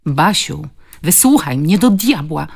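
moderately loud, exasperated plea; mild shout of frustration. A narrator's reading. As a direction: cry out to be heard, rising in force. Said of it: "krzyknął".

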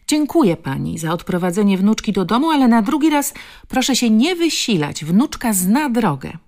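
high, loud, indignant grandmother; curt dismissal. Acted. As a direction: send the stranger off with pointed politeness. Grandmother curtly dismisses the stranger. (podniesiony, zgryźliwy ton babci)

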